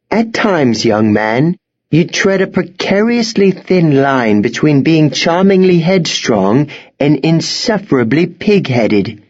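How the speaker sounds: high, proper headmistress scolding; headmistress, reproving. A narrator's reading. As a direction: reprove a headstrong young man.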